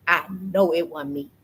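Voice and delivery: ordinary talk, nothing marked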